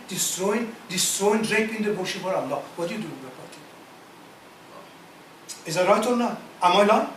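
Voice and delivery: ordinary delivery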